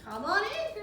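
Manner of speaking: high, loud call from the pool; grandma calling out from the water. Acted.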